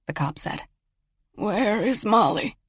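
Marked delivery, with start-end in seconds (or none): 1.3-2.6 slow, higher, frail voice; frail old man. A narrator's reading. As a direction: ask feebly, like a confused grandfather. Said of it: "Marvin asked in a frail voice".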